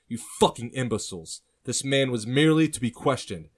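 loud, high, indignant boss; angry scolding. Acted. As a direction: dress down the underlings forcefully. Older man berates his two men.

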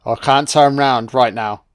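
snowman voice. Pretend. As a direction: play the snowman insisting he isn't alive yet. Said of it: "Snowman's like".

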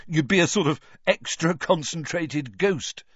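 mild chortle in the voice, no real laugh; joking with a touch of a chortle; chortling. A narrator's reading.

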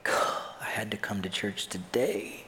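slow, breath-only whispered groan; mock dismay. Preacher voices churchgoer regretting coming today, whispered mock dismay. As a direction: whisper it as a drawn-out complaint. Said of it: "And you're like".

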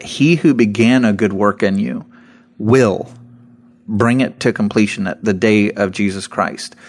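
"will" isolated between two pauses; pause, say "will" firmly, pause; stress on will. Shown as a demonstration. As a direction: let "will" stand on its own. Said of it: "the emphasis on the word will".

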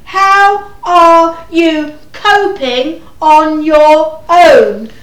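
loud, slow, word by word. Acted. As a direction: spell it out for someone hard of hearing. Repeating for a hard-of-hearing old woman.

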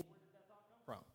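quick whisper to himself; hushed, fast private wondering; whispered question. Shown as a demonstration. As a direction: whisper it fast under your breath. Repeating the question under his breath.

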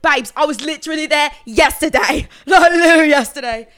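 loud, high British babe voice; hammed-up British protest, rising in volume; put-on British accent. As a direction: insist loudly in a mock British accent. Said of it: "we were doing accents all the time".

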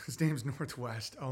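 ordinary delivery

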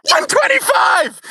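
high, drawn-out yell; prophetic shout. Pretend. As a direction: scream the prediction like a prophet. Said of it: "wake up all your neighbors screaming".

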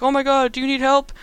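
high mock-concerned hero; put-on high, worried voice; Spider-Man. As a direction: offer help in hammed-up concern. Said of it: "he's like".

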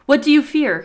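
loud, high-pitched question; asking aloud. A narrator's reading. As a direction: call the question out into the trees. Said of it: "he asked".

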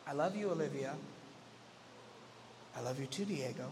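whisper-soft toddlers saying I love you; tiny kid voices. Retold or imitated. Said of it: "one of their tiny voices".

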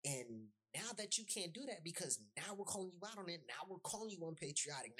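normal talking voice

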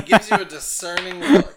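high, loud, words breaking into laughter; laughing. Real, not acted. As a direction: talk while cracking up. Game master cracking up at player.

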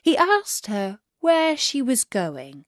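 ordinary delivery, nothing marked

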